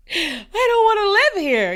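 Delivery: high, loud, drawn-out mock wail; hammed-up wailing protest. Recalling walking through the dirty house, exaggerated wailing protest. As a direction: wail it like a kid refusing, half laughing. Repeats retold dismay, much bigger.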